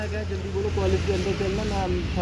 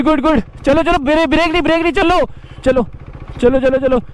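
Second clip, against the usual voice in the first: loud, higher, coaxing coach calls to a nervous rider; 6 semitones higher, 8 dB louder than usual. Real, not acted. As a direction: shout encouragement over the bike engine. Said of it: "कुछ नहीं होगा यार, डरो मत".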